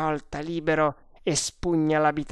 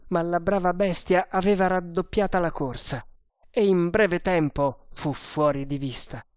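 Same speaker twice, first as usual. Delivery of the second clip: plain reading voice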